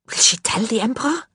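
usual speaking voice

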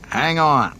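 loud, high warning to the crew; ordering. A narrator's reading. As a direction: call it out as a sharp command. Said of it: "he ordered".

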